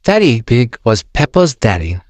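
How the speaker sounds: plain reading voice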